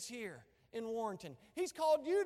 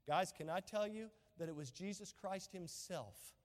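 usual speaking voice

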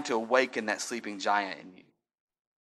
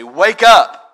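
sudden high, loud call; shout wake up; 6 semitones higher, 18 dB louder than usual. Real, not acted. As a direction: shout it to raise the dead. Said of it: "call into those dead things and just say".

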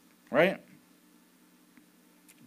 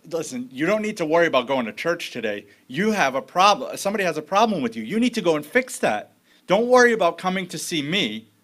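louder, pressing urgency; urgent Jesus voice; 4 semitones higher, 6 dB louder than usual. Retold or imitated. Jesus urging the listener to skip church, louder and urgent. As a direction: push them out the door to go fix it. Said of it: "almost like he would say".

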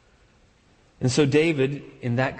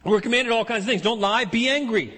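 lightly voiced friend, pitched higher; mock a literal-minded friend with a higher voice; a hint of the friend's earnest voice, higher; imitating a friend; 8 semitones higher than usual. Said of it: "and he goes".

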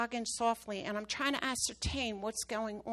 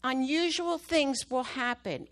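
preaching exhortation; 4 dB louder than usual. Acted. Exhorts the church mid-testimony.

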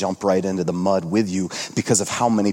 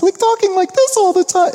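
put-on much higher, louder cooing baby talk; 7 dB louder than usual. Shown as a demonstration. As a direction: squeak it out like talking to a newborn. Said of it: "high-pitched little baby voice".

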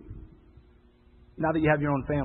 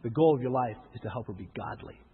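low firm correction; 7 semitones lower, 7 dB quieter than usual. Acted.

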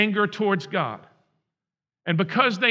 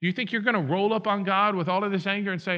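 ordinary talk

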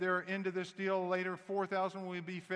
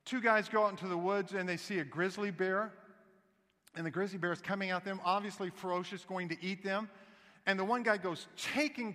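normal talking voice

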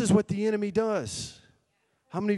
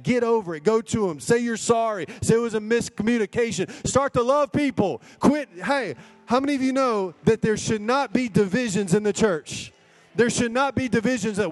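high-pitched, urgent preacher's commands; forceful preaching; 6 semitones higher, 4 dB louder than usual. Real, not acted. Preacher telling church to end divisions, forceful exhortation. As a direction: exhort the congregation in a raised pitch.

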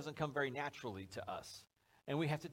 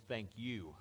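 low, soft mock-grateful aside; sly thanks; 6 semitones lower, 7 dB quieter than usual. Parent back at the sidewalk eyeing candy, sly. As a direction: say it privately, pleased with yourself.

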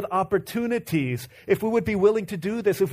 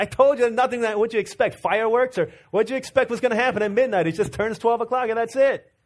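raised, exasperated father's voice; imitated fed-up dad, pitched up; upset dad; 4 semitones higher than usual. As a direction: scold the kids in mock exasperation. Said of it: "he's upset with them".

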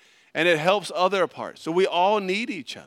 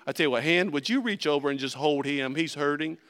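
ordinary talk

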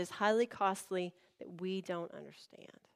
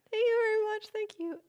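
very high, strained polite thanks; acted near-tearful squeal; holding back tears; 12 semitones higher than usual. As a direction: squeak out thanks while trying not to cry. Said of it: "trying not to cry".

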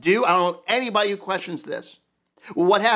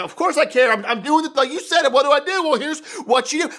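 mock baffled husband; 7 semitones higher, 4 dB louder than usual. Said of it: "he's looking, like".

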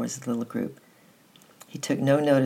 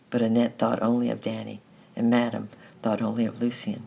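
ordinary delivery, nothing marked

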